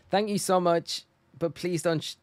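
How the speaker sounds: high flustered thanks. Real, not acted. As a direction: gush thanks while protesting. Thanking and protesting gift.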